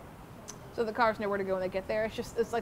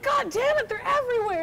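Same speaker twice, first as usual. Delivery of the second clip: loud furious outburst, pitched way up; retold tourist's furious yell; angry yell; 8 dB louder than usual. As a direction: blow up at everyone.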